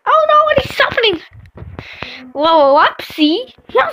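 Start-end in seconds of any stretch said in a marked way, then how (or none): 0.1-1.2 alarmed cry. Real, not acted. Alarmed at the game.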